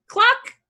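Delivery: short, high, loud cluck; one sharp hen noise; chicken cluck. A narrator's reading. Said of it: "said a chicken".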